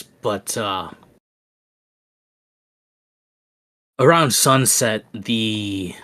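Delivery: lightly voiced slow, halting local; unhurried, hesitant in-character reply; fisherman character. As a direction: answer haltingly, taking time over each phrase.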